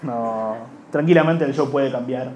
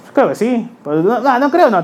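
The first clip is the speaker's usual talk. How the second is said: unsure hedging; 7 semitones higher, 6 dB louder than usual. Retold or imitated. Hesitant reply, self-correcting.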